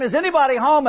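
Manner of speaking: high-pitched calling into the dark; hollering out. Retold or imitated. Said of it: "he says it one more time".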